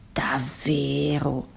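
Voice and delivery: soft, very slow wonder; taken aback. A narrator's reading. Starfish hearing stars belong in the sky, wondering. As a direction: draw it out softly, wondering. Starfish believes the squid's claim.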